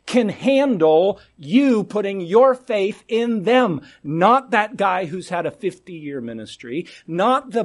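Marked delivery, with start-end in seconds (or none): none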